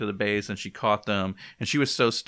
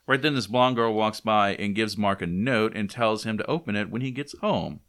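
normal talking voice

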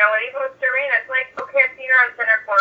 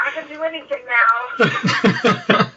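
slow, laughing through the words; laughing giddy boast; 3 semitones higher, much slower than usual. Retold or imitated. Podcast guest recalling her elation, slow and laughing. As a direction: savour it with a laugh.

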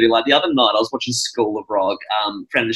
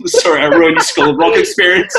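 laughing apology. Real, not acted. Laughing through awkward apology.

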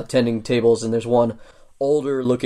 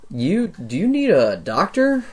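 high dwarf clerk voice; 6 semitones higher, slower than usual. Acted. Dwarf clerk questions injured visitor.

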